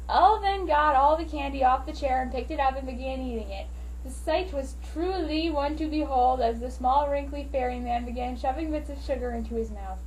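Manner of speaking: ordinary delivery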